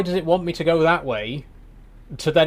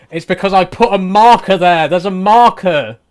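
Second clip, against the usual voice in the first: exasperated realisation; 3 semitones higher, 9 dB louder than usual. Real, not acted. Driver realising the marker caused the loop, loud exasperated shout. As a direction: blurt it out as the penny drops.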